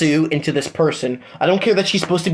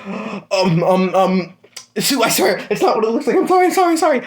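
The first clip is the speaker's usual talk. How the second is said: panicked apology; 7 semitones higher than usual. Acted. Deku begging Sue not to hit him, frantic. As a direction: beg fast not to get hit.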